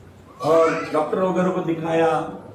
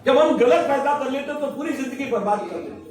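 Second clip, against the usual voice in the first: higher, raised preaching voice; 5 semitones higher than usual. Acted. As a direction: press the lesson home to the flock. Preaches to the congregation. (ऊँची आवाज़ में उपदेश देते हुए)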